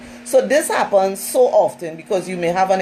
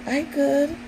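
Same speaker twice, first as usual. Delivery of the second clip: higher and softer, sorry-for-herself answer; mock pitiful reply; 7 semitones higher, 4 dB quieter than usual. Friend fishing for sympathy, soft high pitiful reply. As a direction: sound pitiful so she'll be asked.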